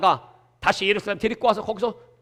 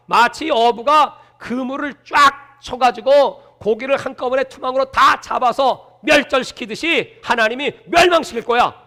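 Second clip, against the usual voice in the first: ordinary talk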